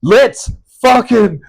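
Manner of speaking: loud, high, slow cheer of an eager fan; excited fan shout. Retold or imitated. Fans cheering about a game in New Zealand, loud excited shout. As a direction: bellow it like a hyped fan hearing the news. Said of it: "it would be like".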